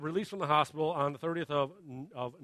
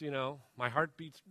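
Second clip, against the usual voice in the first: usual speaking voice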